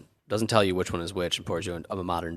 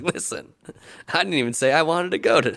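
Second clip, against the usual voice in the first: raised, high-pitched mock indignation; exasperated retold protest; 10 semitones higher, 8 dB louder than usual. Defending himself to girlfriend's family, exasperated retold protest. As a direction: push back loudly, voice jumping up.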